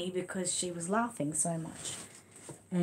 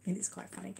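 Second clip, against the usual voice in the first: usual speaking voice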